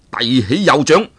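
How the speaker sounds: ordinary delivery, nothing marked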